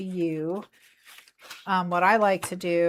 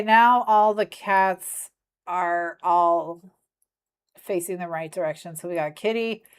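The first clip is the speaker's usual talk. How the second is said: normal talking voice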